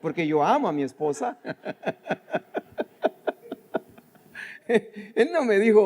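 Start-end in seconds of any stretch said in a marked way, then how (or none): 1.4-3.8 high breathy laugh. Real, not acted. Laughs after joke about wife. 4.7-5.8 laughing. Real, not acted.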